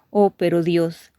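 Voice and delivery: ordinary talk, nothing marked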